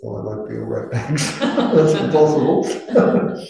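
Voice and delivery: laughing through the words, slowed. Real, not acted. Teacher joking he can't be perfect, laughing. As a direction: chuckle it out at yourself. Jokes about himself, laughing.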